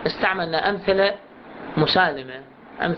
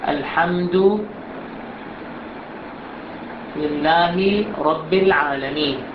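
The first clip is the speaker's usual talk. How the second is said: drawn-out, measured chanting; slow recitation; 4 semitones lower, much slower than usual. Shown as a demonstration. Teacher models the verse recitation to students. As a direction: recite slowly phrase by phrase. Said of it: "شلون نقراها".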